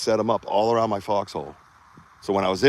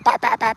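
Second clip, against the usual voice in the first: shouted gunfire noises; 6 dB louder than usual. Retold or imitated. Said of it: "fucking screaming".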